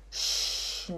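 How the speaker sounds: "schnell" with long SH and stretched ending; drawn-out demonstration. Shown as a demonstration. Teacher modelling for students to repeat, drawn-out sounds. Slow model after "Fala comigo.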